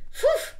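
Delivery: squeaky high phew; high phew bark. Shown as a demonstration. Reader shows the backwards bark, very high. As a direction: pop it out high. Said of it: "Can you say it?".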